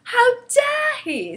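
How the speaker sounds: very high, loud, drawn-out outrage; scandalised little girl; outraged cry. A narrator's reading. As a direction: cry out slowly in indignant shock. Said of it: "Sophie cried".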